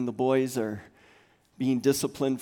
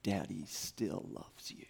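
tender whisper; 5 semitones lower, 11 dB quieter than usual. Retold or imitated. Dad reassuring his upset son, whispered. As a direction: hold him close and whisper it. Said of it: "I say".

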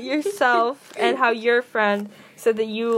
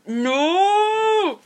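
long, high, loud wail; distressed yell; 8 semitones higher, 5 dB louder than usual. Real, not acted. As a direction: cry out in protest, drawn out. Duct tape stuck on speaker.

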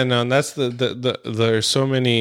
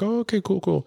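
high, soft, quick brush-off okay; easygoing agreement; 10 semitones higher, 4 dB quieter than usual. Retold or imitated. Telling how Hollywood usually responds, easygoing mock agreement. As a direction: lightly mimic someone happily going along. Retelling celebrities' easy agreement.

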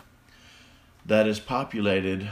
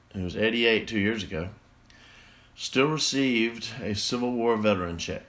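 ordinary talk

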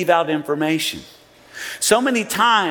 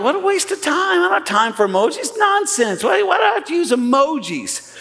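exasperated; 10 semitones higher than usual. Retold or imitated. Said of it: "And I'm like".

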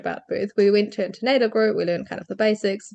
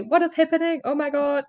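higher, breathless questions; mild mock panic; retold panic; 7 semitones higher than usual. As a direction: relive the frantic arrival. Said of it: "frantic, panicky".

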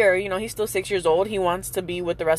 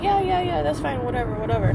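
mock-breezy teacher, much higher on the yeahs; 7 semitones higher than usual. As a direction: toss off the teacher's careless yes-yes-yes. Mimicking the teacher's breezy reply.

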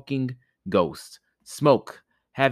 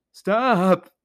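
high, loud ghostly cry; 12 semitones higher, 7 dB louder than usual. Acted. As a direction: shriek stop like a hurt skull. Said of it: "cried the skull".

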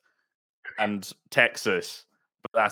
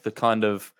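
ordinary talk, nothing marked

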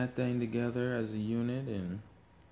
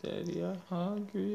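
put-on high whiny little-kid voice; imitating toddler; 11 semitones higher than usual. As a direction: whine like a hungry toddler. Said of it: "baby said".

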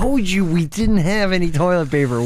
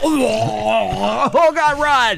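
much higher, frantic character voice; high panicked voice; 6 semitones higher, much slower than usual. Acted. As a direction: squeal it out in panic.

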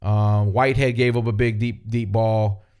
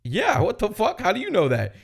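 retold own surprise; 9 semitones higher than usual. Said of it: "I was like".

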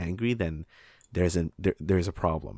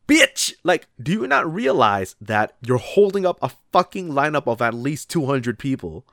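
high, loud mock tirade; hammed-up furious rant at the customer; angry rant; 7 semitones higher, 5 dB louder than usual. Cashier ranting at the tourist's daughter, loud mock anger.

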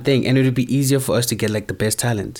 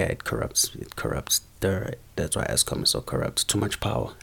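muttered aside; 4 semitones lower, 9 dB quieter than usual. Real, not acted. Joking about Eskom corruption, a whispered aside. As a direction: whisper it under the breath.

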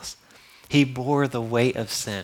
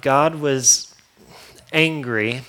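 ordinary talk, nothing marked

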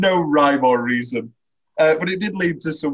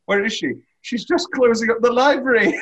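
mock dismay; 8 semitones higher, 4 dB louder than usual. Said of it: "It's like".

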